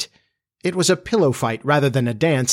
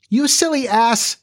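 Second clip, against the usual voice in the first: tiny high-pitched fairy in a passion; Tinker Bell; 11 semitones higher than usual. A narrator's reading. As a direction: squeal it indignantly, very high. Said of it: "cried Tinker Bell in a passion".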